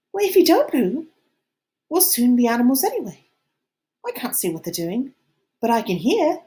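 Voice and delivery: fretful, pushing Ralph to act; lightly voiced Piggy. A narrator's reading.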